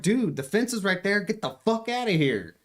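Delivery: hammed-up get-out command; mock yell. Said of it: "I'm like".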